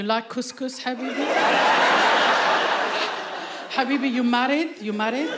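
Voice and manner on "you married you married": ordinary talk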